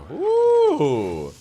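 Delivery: loud high whoop of joy; excited whoop. Real, not acted. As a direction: let out a happy cheer.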